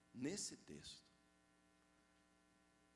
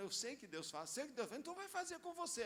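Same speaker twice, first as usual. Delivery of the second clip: mock believer; 11 semitones higher than usual. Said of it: "e falar".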